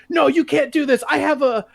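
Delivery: high, panicky pleading; whiny mock plea. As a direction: beg like a man being cuffed. Agent pleading while cuffed.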